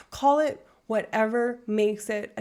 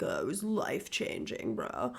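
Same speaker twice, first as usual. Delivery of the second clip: imitated tripper bro; 7 semitones lower, 9 dB quieter than usual. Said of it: "they're like".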